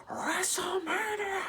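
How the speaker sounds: drawn-out, high-pitched announcer growl, badly imitated; hammy welcome roar, held long and high; terrible Vince McMahon accent. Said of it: "That's a terrible accent".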